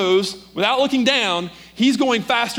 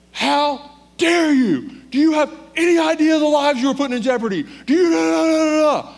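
high, loud imitation of a screaming patrolman; furious yelling; 11 semitones higher, 5 dB louder than usual. Patrolman berating a pulled-over driver, furious yelling. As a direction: tear into the driver at the top of your lungs. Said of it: "how loud he was yelling at Kelly".

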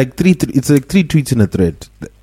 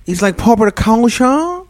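higher-pitched, affected faux-Western accent; put-on proper accent; 8 semitones higher than usual. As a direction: exaggerate a fake 'proper' accent. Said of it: "an accent is just, like, how people talk proper".

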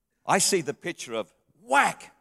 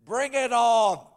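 put-on brave child; 7 semitones higher, slower than usual. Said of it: "and she says".

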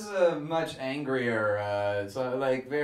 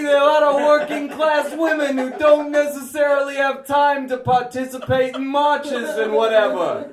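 put-on Bronx accent; 8 semitones higher, 6 dB louder than usual. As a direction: holler it in a brassy Bronx voice. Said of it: "the feminist from the Bronx".

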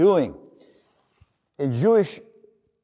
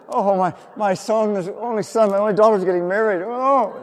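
high, louder hammed-up mourner's lament; mock lament; 7 semitones higher, 7 dB louder than usual. Preacher mimics a hired wailer, high hammed-up lament. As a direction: play a paid wailer overdoing the grief.

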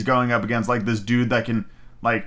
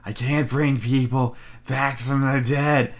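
Igor voice. Retold or imitated. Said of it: "fucking Igor".

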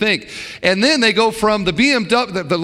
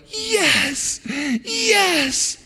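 high, excited yes from the pews; mock congregation cheer; 11 semitones higher than usual. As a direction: cheer like a fired-up listener. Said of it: "like that".

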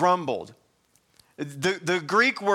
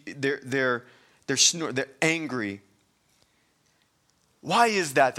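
usual speaking voice

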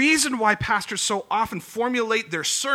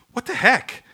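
baffled exclamation; 5 semitones higher, 6 dB louder than usual. Retold or imitated. Baffled reader of temple laws.

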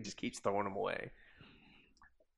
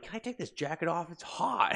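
put-on whiny kid; 8 semitones higher than usual. Boy complaining about jacket.

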